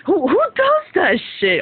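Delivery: high, loud, worked-up ranting; incredulous high outburst; outraged disbelief. Real, not acted. Outraged rant about the car.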